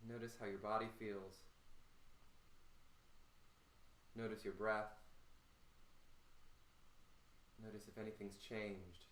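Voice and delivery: soft, low, with long pauses; calm guiding. Real, not acted. Coach asks them to notice breath, soft low guiding. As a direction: quietly lead them into noticing their body. Coach guides a settling body check.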